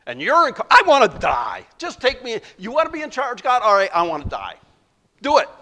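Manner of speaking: loud, sulky rant; petulant outburst. Acted. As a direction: throw a tantrum at God. Jonah's angry outburst at God.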